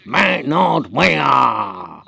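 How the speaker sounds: exaggerated long yowl, falling at the end; slow teaching demo of a cat's catchphrase; demonstrated cat catchphrase. Shown as a demonstration. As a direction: drag it out very slowly, like the cat. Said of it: "And it's like this".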